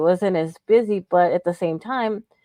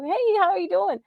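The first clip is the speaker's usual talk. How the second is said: cheery greeting; 11 semitones higher than usual. Retold or imitated.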